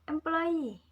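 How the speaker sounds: "employee" with final EE high and loud; stress on the suffix. Shown as a demonstration. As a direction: push the last syllable of "employee", then fall. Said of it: "the following suffixes need to be imposed".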